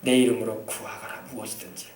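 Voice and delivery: low, hushed, measured divine voice; deep, quiet voice of the Lord; the Lord's promise. Acted. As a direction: speak God's promise low and soft, with pauses.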